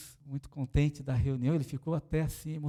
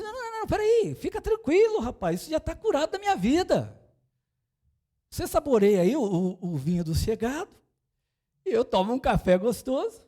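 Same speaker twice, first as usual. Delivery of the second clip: much higher, louder, hurried no-no-no; eager reassurance; 11 semitones higher, 6 dB louder than usual. Retold or imitated. Pastor reassuring the host, high and loud. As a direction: wave off the worry, brightly insistent.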